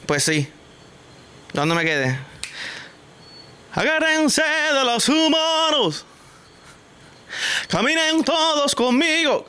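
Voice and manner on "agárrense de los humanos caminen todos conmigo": high, slow, put-on announcer command; mock commanding voice. Walking vlogger playfully commanding the humans, high slow mock voice. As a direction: boom it out like a silly leader.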